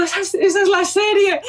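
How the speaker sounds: high, near-tearful thrill of recognition; retold excitement. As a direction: voice jumps up, almost crying with joy. Retold spotting her series on tour.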